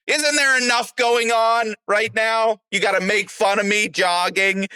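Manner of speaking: high hurt voice asking why he mocked; whiny hurt plea. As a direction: plead like your feelings are hurt. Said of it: "I was like".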